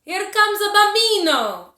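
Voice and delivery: put-on loud, high and joyful, announcing the baby to everyone; calling out. A narrator's reading. Aunt at the farmhouse door announces the baby, loud joyful call. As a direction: shout it out across the farmyard. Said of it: "called out".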